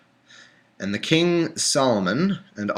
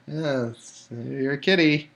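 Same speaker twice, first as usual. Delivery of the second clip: cooing to a cat; 3 semitones higher, 4 dB quieter, slower than usual. Real, not acted. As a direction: coo at the cat.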